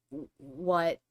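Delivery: ordinary talk, nothing marked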